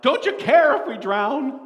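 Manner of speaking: loud, high panicked cry. Acted. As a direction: shout over the storm in fear. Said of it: "they cried out".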